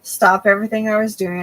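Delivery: normal talking voice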